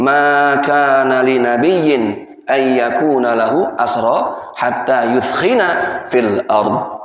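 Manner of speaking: slow, low, measured Arabic recitation; Quran recitation. Acted. Preacher recites the revealed verse to listeners, slow chanted recitation. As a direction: recite the verse with long drawn-out syllables.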